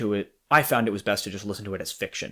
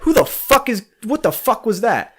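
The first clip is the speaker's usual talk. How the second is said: loud and much higher, startled swearing; natural alarmed reaction; 11 semitones higher, 7 dB louder than usual. Retold or imitated. Showing a friend how people really react, startled shout. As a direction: yell it like a real person hearing a bang. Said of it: "When normally you'd be like".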